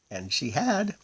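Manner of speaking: plain reading voice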